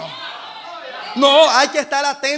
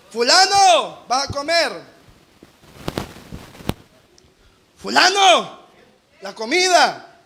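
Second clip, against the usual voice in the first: mock calling someone to dinner; calling out to eat; slower than usual.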